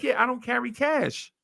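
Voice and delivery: lightly voiced carefree friend; mock friend voice. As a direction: say it like it's nothing. Friend's retold reply.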